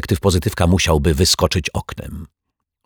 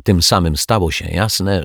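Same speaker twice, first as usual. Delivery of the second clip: usual speaking voice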